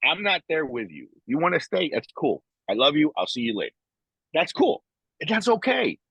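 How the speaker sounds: high-pitched, easygoing let-it-go; retold breezy dismissal. Man retelling how he left his wife to it, breezy. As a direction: wave her off lightly, pitch riding up. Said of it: "You know, I said".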